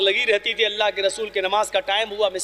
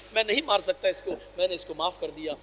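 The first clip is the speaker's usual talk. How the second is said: much softer, lower, gentle resolve; soft forgiving close; 4 semitones lower, 7 dB quieter than usual. Acted. Prophet telling Aisha he forgave the girl, soft and gentle. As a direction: let the words fall away gently.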